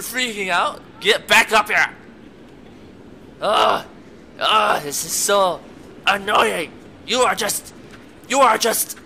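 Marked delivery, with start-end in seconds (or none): 1.0-1.9 loud, high scolding command; annoyed yell. Real, not acted. Gamer ordering a panicking companion back up, annoyed yell. 3.4-9.0 loud exasperated ranting; frustrated groan. Real, not acted. Gamer fed up with a stuck companion, loud frustrated rant.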